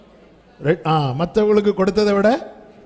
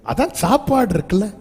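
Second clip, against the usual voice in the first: mocking husband; 7 semitones higher than usual. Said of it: "சொல்ற மாதிரி சொல்றாரு".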